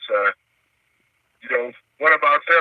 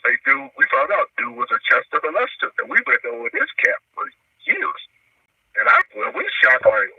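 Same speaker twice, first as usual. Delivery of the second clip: normal talking voice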